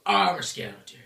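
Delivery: low, loud monster voice; skeleton boss. Acted. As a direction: announce it like a goofy skeleton monster. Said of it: "he's like".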